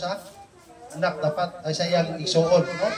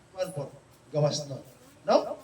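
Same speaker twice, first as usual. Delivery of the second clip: ordinary talk, nothing marked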